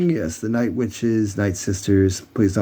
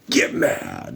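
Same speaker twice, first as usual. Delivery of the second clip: much lower growl, slow and heavy; deep witch voice; 4 dB quieter than usual. Retold or imitated. Imitating the witch urging May to get mad, very deep. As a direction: drop way down like the witch commanding. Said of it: "she's also got this really deep".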